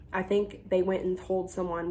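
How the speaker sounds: ordinary delivery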